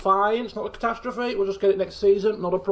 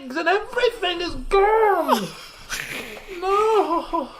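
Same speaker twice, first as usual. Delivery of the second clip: laughing despair; 9 semitones higher, slower than usual. Real, not acted. Mourning lost kegs.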